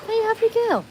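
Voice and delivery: extremely high dog-cooing voice; tip-top pitch, gushing pet voice; squeaky pet talk. Real, not acted. Asking the dog, higher take.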